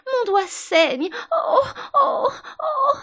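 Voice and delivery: very high, slow, loud wail; howling in pain. A narrator's reading. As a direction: shriek like a steam engine's whistle. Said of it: "Ces cris ressemblaient si fortement au sifflement".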